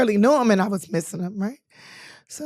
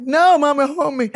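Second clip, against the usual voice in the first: laughing plea; 6 semitones higher, 5 dB louder than usual. Retold or imitated. Begging mom to hold her.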